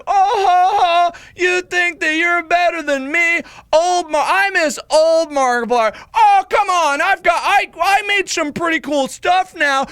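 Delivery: loud, very high, drawn-out wailing; mock meltdown at full volume; hammed-up insane argument. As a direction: bicker with yourself in a hysterical falsetto. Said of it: "a little bit insane here".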